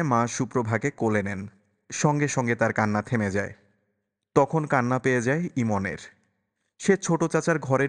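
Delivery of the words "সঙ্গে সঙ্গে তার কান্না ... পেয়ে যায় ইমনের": usual speaking voice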